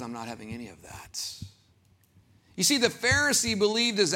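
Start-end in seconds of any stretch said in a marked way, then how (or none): 0.0-1.2 quiet dismissal. Retold or imitated. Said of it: "And God says".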